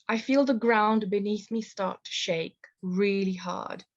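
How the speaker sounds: usual speaking voice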